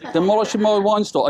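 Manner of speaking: laughing, amused by the memory. Real, not acted. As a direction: repeat it through a laugh. Laughing repeat of wine joke.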